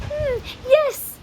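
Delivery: high-pitched, hesitant wizard voice; flustered wizard. A narrator's reading. Wizard asked for magic, flustered. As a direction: stall in a high, fussy voice.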